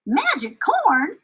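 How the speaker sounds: drawn-out wonder at magic corn; girl gasping in awe; amazed. Acted. Juanetta amazed.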